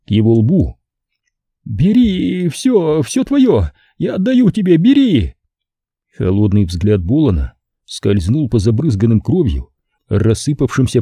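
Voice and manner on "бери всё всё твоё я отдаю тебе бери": high, frantic begging pimp voice; a terrified bar owner offering all his money; panicked pimp pleading. Acted. Terrified Simon begging the killer to take the money, high and frantic.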